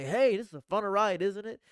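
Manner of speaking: high, loud, thrilled shout over the roller coaster; excited call on a ride. Pretend. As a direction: cheer your neighbour on mid-ride. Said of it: "and I'm gonna say".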